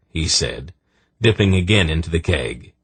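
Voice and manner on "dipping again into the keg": plain reading voice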